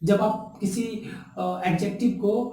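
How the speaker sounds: ordinary talk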